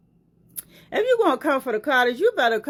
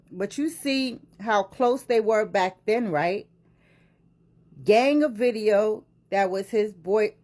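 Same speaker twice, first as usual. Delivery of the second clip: ordinary delivery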